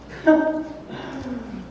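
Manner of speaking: high, derisive laugh; scornful laugh. Acted. Roman governor laughing at a priest's warning, scornful. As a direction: laugh off the very idea.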